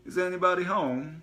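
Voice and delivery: put-on high, loud foreign-accented Is anybody home; foreign accent call. Gas man calling into the house, foreign accent. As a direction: sing it out in a jovial foreign accent. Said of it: "foreign accent from around the corner".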